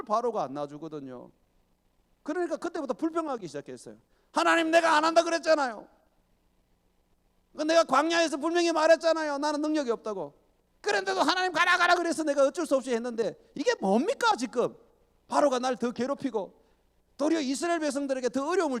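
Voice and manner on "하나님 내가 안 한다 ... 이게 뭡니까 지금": high, loud exasperated complaint; Moses complaining. Acted. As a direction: whine at God like a fed-up servant.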